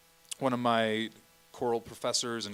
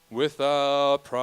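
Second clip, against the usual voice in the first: Without price sung slow with a louder cutoff; cutoff demo; 3 semitones higher, 5 dB louder, much slower than usual. Shown as a demonstration. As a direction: show where Without price cuts off. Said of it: "some of these I'm gonna have to give you kind of in the middle".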